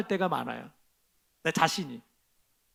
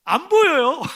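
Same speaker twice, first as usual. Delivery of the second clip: frustrated cry; 9 semitones higher, 9 dB louder, slower than usual. Real, not acted. Frustrated pastor can't see cable.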